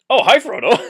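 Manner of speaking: cartoon Gandalf greeting. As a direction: switch instantly to sugary friendliness. Gandalf suddenly friendly.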